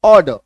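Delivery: ऑर्डर punched out as "aw-duh"; silent R. Shown as a demonstration. Teacher answering own question, ऑर्डर with silent R. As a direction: say ऑर्डर loudly with no R. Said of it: "इसका उच्चारण क्या होता है".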